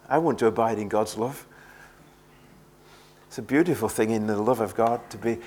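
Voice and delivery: ordinary talk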